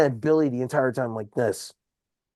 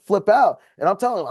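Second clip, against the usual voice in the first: usual speaking voice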